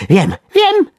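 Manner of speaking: very high, loud worm squeal; squeak. A narrator's reading. Worm suddenly finding a way out, high squeak. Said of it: "pisnął".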